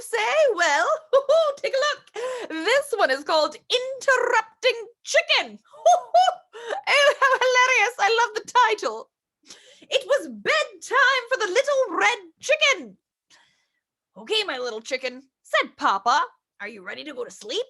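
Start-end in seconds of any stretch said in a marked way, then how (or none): 0.9-1.5 light amused laugh; a happy little chuckle. Real, not acted. Grandma laughs at title. 5.6-8.0 amused laugh into words. Real, not acted. Laughing at funny title. 14.2-15.2 deeper, softer father voice; gentle bedtime dad; low papa voice. Acted. Reads Papa's lines. 16.6-17.6 deeper, softer father voice; gentle bedtime dad; low papa voice. Acted. Reads Papa's lines.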